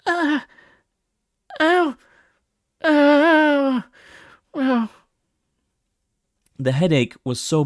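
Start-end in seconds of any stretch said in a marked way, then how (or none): 0.1-4.9 high, loud yelps of pain; cries of pain. Acted. Moon hit by a blistering headache in her mind, loud pained cries. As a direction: cry out as the headache stabs.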